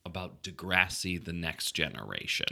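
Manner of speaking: hushed, low radio DJ delivery; soothing nineties radio DJ. Pretend. Host quizzing wife on Degrassi, soothing DJ voice. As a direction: keep it low, soft and smooth. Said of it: "soothing, like, nineties radio D J".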